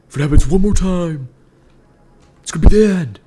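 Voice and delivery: high, loud final warning; angry threat. Pretend. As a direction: yell a mock-furious ultimatum. Mock raging threat at the game.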